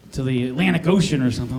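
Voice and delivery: normal talking voice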